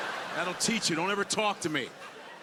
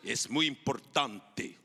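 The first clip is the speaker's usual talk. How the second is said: showy Spanish; 8 semitones lower, slower than usual. Shown as a demonstration. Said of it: "my Spanish is beautiful".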